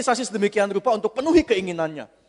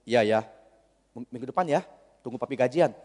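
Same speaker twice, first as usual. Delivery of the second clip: placating father; 9 semitones lower, 4 dB quieter than usual. Acted. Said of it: "Papinya ngomong".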